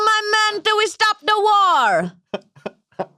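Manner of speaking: very high, hammed-up Jamaican accent; sustained high mock-Jamaican chant; bad Jamaican accent. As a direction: proclaim it in a terrible Jamaican accent. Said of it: "This might be the worst Jamaican accent ever".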